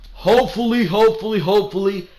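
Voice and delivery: high and loud, fading down each time; wishing hard it stays that way; eager hope that trails off; pleading hope. Real, not acted.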